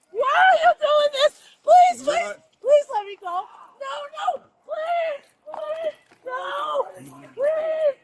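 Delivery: frantic begging, high pitched; desperate pleading. Real, not acted. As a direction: beg them to stop, panicked. Begging officers during arrest.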